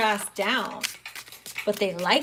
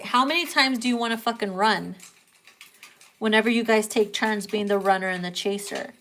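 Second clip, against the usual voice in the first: ordinary talk, nothing marked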